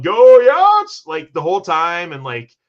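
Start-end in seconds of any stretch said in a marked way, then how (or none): 0.0-1.1 loud, high-pitched crowd cheer; yelling fan. Acted. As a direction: yell it like a rowdy supporter. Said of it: "people are out there yelling".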